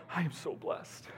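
hushed, half-voiced imitation of a moved pastor; retold tearful gratitude. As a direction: say it softly, as if overcome. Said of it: "he was crying".

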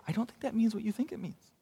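soft, fast dry movie-line delivery; Princess Bride quote. Retold or imitated. Preacher quoting The Princess Bride at misused verses, soft and dry. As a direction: toss off the famous line under the breath. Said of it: "if any of you have ever seen The Princess Bride before".

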